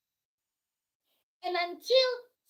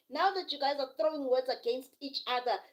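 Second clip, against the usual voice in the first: usual speaking voice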